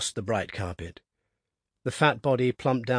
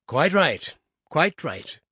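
hearty agreement; 4 semitones higher, 4 dB louder, slower than usual. A narrator's reading. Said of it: "cried the boss".

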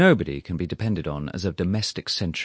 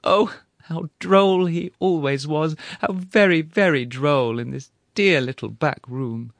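gushing widow; 9 semitones higher, 5 dB louder than usual. Acted. Widow gushing over late husband.

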